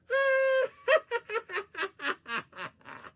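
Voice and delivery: high, loud cackling laugh; distant laughter. Acted. Borgita far off laughing at Coyote, loud high cackle. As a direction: cackle gleefully at the fooled coyote. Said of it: "Borgita was laughing at the trick".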